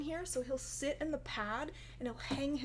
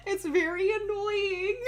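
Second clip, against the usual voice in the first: laughing; 7 semitones higher, 5 dB louder than usual. Real, not acted. Laughing while talking about dog.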